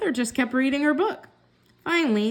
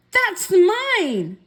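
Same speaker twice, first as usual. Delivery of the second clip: much higher, louder protest; indignant sister; 9 semitones higher, 5 dB louder than usual. A narrator's reading. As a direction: catch him red-handed. Sarah catches Ben with doll.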